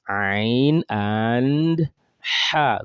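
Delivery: Ain and Ha stretched slowly; slow drawn-out Ain and Ha. Shown as a demonstration. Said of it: "let's start with Ain".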